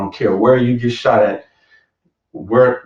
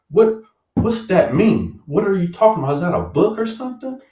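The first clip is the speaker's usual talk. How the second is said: squeaky, baffled asking; high-pitched bewildered queries; high confused questions; 9 semitones higher than usual. Retold or imitated. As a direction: sound lost and curious.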